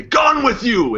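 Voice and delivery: high-pitched yell to drive someone off; acted warning shout. Archer shooting an arrow into the ground, acted warning shout. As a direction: shout it high, as if scaring them away.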